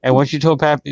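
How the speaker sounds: ordinary talk, nothing marked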